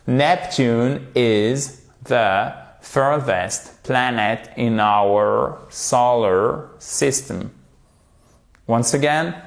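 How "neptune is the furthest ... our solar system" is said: slow model sentence, word by word; slowly, carefully. Shown as a demonstration. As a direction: take it gently, with gaps between words. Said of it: "Alors, faites-le doucement si c'est un peu difficile". (lentement, mot par mot, en articulant)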